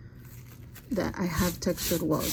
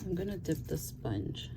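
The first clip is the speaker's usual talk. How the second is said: quiet at night; 6 dB quieter than usual. Real, not acted. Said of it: "my son is asleep".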